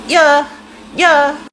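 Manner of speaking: high, loud, short squeak; celebrity impression. Answering a fan as [name], high loud squeak.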